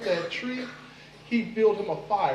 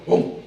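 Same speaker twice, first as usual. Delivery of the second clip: coconut chop noise; 8 dB louder than usual. Acted. Machete splits the coconut.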